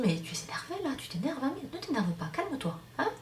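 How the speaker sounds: annoying calm-down advice. Acted. Someone telling an edgy person to calm down, lightly mock-soothing. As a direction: imitate someone needling with soft, lilting reassurance. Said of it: "quand quelqu'un vous dit".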